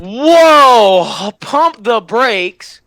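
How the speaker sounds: loud, high shocked shout, then a slow drawn-out command; startled shout. Real, not acted. Vlogger startled by grim story detail. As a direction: yell in shock at the reading.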